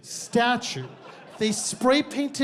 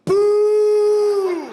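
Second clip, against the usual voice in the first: loud high mock boo; crowd booing; 13 semitones higher, 7 dB louder than usual. Supergroup turning on mom, loud high boo. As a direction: jeer it like a whole crowd, much higher and louder. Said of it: "just goes".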